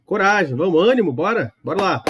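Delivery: rally the class to join in; teacher cheering students on. Real, not acted. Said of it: "cheering on students".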